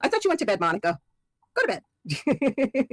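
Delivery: high, teasing mock scold. Real, not acted. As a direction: scold her playfully for staying up. Teasing Monica still awake.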